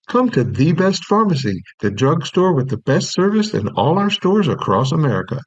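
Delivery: upbeat announcer read; radio ad read. Acted. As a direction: sell it like a pharmacy radio spot. Reads the radio commercial.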